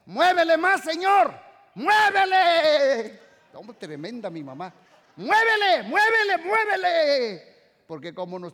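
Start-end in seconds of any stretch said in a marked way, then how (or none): 0.1-3.2 high, loud, drawn-out cry to shake harder; mom's defiant shout. Retold or imitated. Mother at the door daring God to shake more, loud high cry. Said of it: "salía para la puerta y decía". 5.2-7.6 high, loud, drawn-out cry to shake harder; mom's defiant shout. Retold or imitated. Mother at the door daring God to shake more, loud high cry. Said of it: "salía para la puerta y decía".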